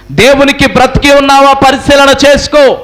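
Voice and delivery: high, sustained sermon cry; preaching at full force. Real, not acted. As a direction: declaim it to the congregation in long high-pitched phrases. (గట్టి స్వరంతో ప్రసంగం)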